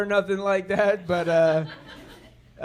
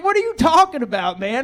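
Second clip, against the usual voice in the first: high, louder mock outrage; mock indignant wife; 5 semitones higher, 5 dB louder than usual. Wife disbelieving husband's cab story, high and louder. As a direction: push back at him in disbelief.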